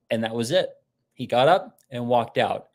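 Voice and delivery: normal talking voice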